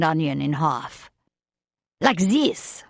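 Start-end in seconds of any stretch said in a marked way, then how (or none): 2.0-2.8 put-on French accent. A narrator's reading. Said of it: "with that strong French accent".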